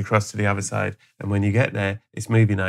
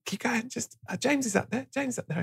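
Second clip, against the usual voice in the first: much higher and softer, urging; hushed high coaxing to a frail old man; high coaxing whisper; 12 semitones higher, 9 dB quieter than usual. Retold or imitated. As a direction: sweetly lure him onward. Coaxing Tom toward James.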